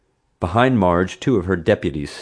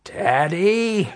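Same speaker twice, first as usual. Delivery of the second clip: much higher angry yell of a name; roar; 13 semitones higher than usual. A narrator's reading. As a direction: bellow his name in fury. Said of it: "she roared".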